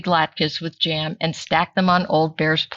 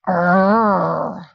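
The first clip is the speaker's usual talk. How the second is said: bear roar. Acted. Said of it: "Old Bear roared".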